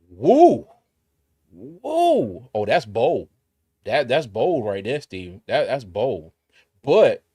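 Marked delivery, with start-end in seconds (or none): 0.1-2.5 higher and louder hype yell; excited whoop. Real, not acted. Streamer reacts to Steven's bold scoring claim, whooping. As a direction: whoop it up like a fan.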